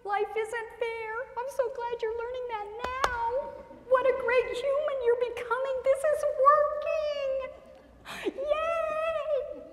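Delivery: high, sing-out mock delight; gleeful cheering parent. Parent delighted the child is learning, exaggerated glee. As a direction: cheer the tantrum on in a high, stretched voice. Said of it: "And we're gonna go".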